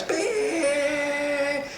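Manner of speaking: imitated car horn, held high; one long, high-pitched sustained beep; long horn blare. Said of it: "hammered the horn".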